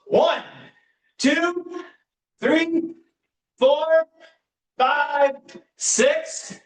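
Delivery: high, energetic count-off; coach counting. Acted. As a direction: pump the class up while counting.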